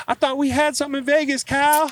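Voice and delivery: high-pitched mock North Carolina accent; Will's North Carolina accent. Teammate appealing to Kyle on the next play, high North Carolina accent. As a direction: plead in a squeaky, high Carolina twang. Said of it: "He's got this North Carolina accent".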